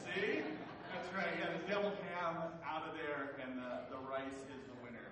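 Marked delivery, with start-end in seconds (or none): none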